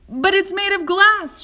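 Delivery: loud, high alarmed cry; terrified of the glass breaking; frightened yell; panicked shout. A narrator's reading. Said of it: "shouted Grandpa Joe".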